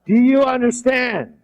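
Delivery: high, slow, forceful call to the room; emphatic check. Shown as a demonstration. Trainer jolting the room after a dull demo, emphatic. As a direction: punch it out high and drawn out.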